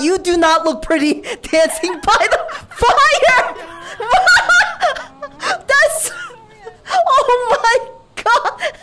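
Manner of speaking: words breaking up into laughter; helpless giggling with pitch up; laughing hard. Real, not acted. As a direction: crack up mid-sentence. Laughing at the character dancing.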